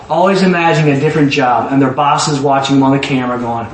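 ordinary talk

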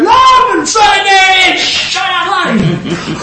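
high, drawn-out acted scream. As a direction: scream it out high to be heard over the crowd. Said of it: "He just starts screaming louder".

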